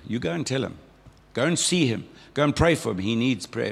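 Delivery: ordinary delivery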